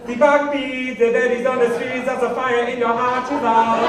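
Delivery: put-on loud, high sung German accent. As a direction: belt it like a German cover band. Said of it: "in a German accent".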